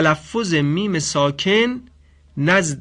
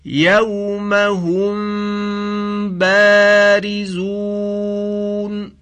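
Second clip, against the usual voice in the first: very slow chanted recitation, higher; Quran recitation; 5 semitones higher, 5 dB louder, much slower than usual. Shown as a demonstration. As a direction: intone it long and drawn out. (تلاوت بسیار کشیده قرآن)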